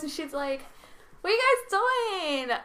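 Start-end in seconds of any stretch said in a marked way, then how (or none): 1.2-2.6 loud, slow, very high imitation; high-pitched distant voice. Imitating the girl who broke the meditation, high drawn-out call. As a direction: mimic a girl calling from a distance. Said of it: "That's how she sounded".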